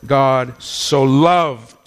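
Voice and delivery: very slow, "loved" drawn out; slow emphatic quote. Shown as a demonstration. Preacher slowly savouring the verse for the church. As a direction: savour each word of "For God so loved". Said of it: "What does that mean to you".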